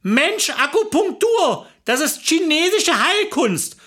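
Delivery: high, drawn-out exclamation; exasperated explaining. Acted. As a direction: impress it on him, pitched high. Wife explains impatiently to husband.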